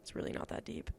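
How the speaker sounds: breathy whispered aside; whispered repeat. Real, not acted. Repeating her point to viewers, in a whisper. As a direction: breathe the repeat almost to yourself.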